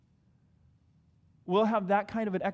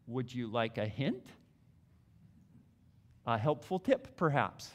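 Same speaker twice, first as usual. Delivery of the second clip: gentle offer; 7 semitones lower, 5 dB quieter than usual. Retold or imitated.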